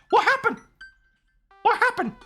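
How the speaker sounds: tiny, very high squeaky voice; comic falsetto squeak; squeaky cartoon impression. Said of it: "That's American".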